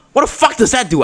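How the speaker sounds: high-pitched, baffled outburst; retold shouting. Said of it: "like, shouting".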